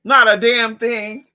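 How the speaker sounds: loud and slow; deadpan loud verdict. Real, not acted. Vlogger after licking own arm, loud slow verdict. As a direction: announce it flatly.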